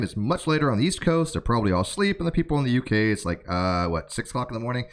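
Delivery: ordinary talk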